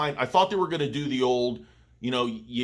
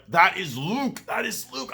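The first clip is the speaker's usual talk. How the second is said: excited; 8 semitones higher, 4 dB louder than usual. Retold or imitated. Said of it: "And I was like".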